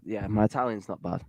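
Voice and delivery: ordinary delivery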